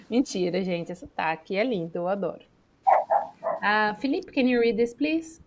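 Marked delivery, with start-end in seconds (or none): none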